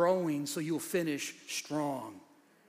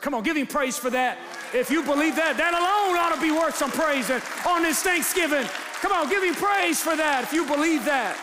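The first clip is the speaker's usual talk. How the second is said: louder, rallying the crowd; calling for praise; 8 semitones higher, 5 dB louder than usual. Real, not acted. Pastor shouting to the congregation for praise on Thanksgiving. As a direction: lift the room into praise.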